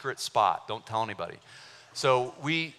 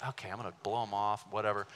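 dismissive muttering to self; 5 semitones lower, 8 dB quieter, faster than usual. Retold or imitated. His dismissive inner thought.

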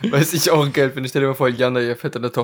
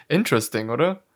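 usual speaking voice

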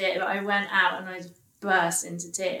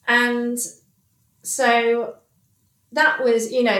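normal talking voice

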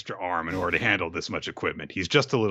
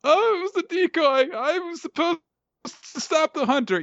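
much higher, timid little voice; squeaky meek voice. Retold or imitated. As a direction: shrink into a squeaky meek underling. Meek werewolf answers scolding.

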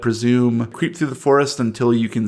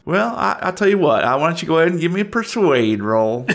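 smug yokel toying with him; Southern yokel; 6 semitones higher than usual. Acted. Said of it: "I'm from the South".

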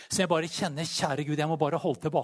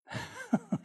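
high short laugh; 6 dB quieter than usual. Real, not acted. Laughs at own futile prayer.

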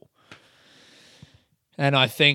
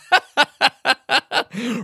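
high, loud burst of laughter; high staccato cackle; laughing; 11 semitones higher, 6 dB louder than usual. Real, not acted. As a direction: crack up at your own silly voice. Cracks up at own silly voice.

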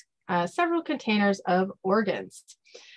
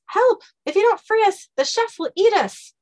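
loud, high little cry for help; high-pitched voice; 13 semitones higher, 6 dB louder than usual. Acted. Captive begging to be freed from the chef, high-pitched. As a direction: plead urgently in a tiny squeaky voice. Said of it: "a high-pitched voice says".

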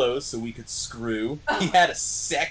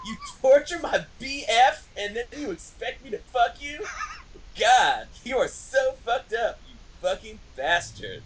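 high, hammed-up outrage; angry; 10 semitones higher than usual. A narrator's reading. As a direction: rant in melodramatic indignation. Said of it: "I said angrily".

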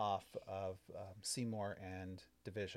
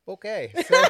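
laughing through the words; giggling while talking; 9 semitones higher, 18 dB louder than usual. Real, not acted. Laughing after hose joke.

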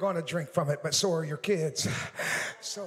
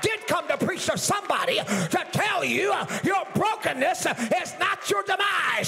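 preaching at full force; 6 semitones higher than usual. Acted. Pastor to his church, full-force preaching shout. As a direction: build the sermon to a shouting climax.

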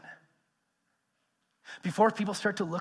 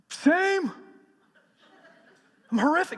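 higher, sheepish confession of failure; high sheepish admission; 9 semitones higher, 5 dB louder than usual. Acted. Robert admitting his failure to Peter, sheepish and higher. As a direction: own it, a little embarrassed.

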